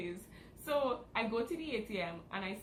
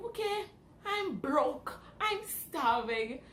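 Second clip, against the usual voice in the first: dramatic despair; 6 semitones higher, 5 dB louder, much slower than usual. Retold or imitated. Retold self despair, broke.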